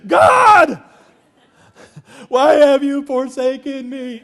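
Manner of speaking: mock-dramatic high cry to heaven; hammed-up desperate prayer. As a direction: wail it loud and drawn out, in jest. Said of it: "I'm praying".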